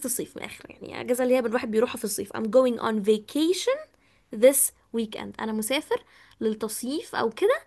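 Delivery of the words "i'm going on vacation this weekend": each word drawn out very slowly; slow model sentence. Shown as a demonstration. Teacher modelling the sentence slowly for students. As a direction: dictate it slowly for the class. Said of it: "ومدوها كده as much as you can".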